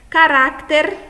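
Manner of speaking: "Caráter" as Spanish carácter, RÁ high and loud; penultimate stress. Shown as a demonstration. Teacher showing the tonic syllable, "Caráter" as carácter with loud middle stress. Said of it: "é a sílaba mais forte".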